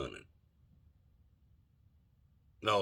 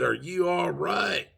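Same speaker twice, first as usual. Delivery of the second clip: mock shout across the yard, higher and slow; retold yell; 10 semitones higher, 5 dB louder than usual. Son yelling to the woman on the ground, higher, slow mock shout. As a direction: call out to the fallen neighbor. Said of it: "he yelled out".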